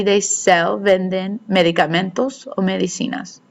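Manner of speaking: usual speaking voice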